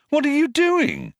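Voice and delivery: high, startled question; exclaimed. A narrator's reading. Ford startled at Marvin lying in the dust, high exclamation. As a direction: blurt it out in surprise. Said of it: "he exclaimed".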